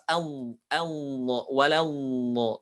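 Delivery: very slow, thick dha sound; slow drilled dha; slow heavy dha. Shown as a demonstration. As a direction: stretch the heavy sound slowly. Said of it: "Jadi bukan do".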